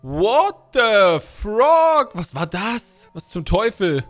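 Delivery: loud, high, drawn-out shock; loud startled yelp easing into disbelief; startled outburst. Real, not acted. Startled by something in game.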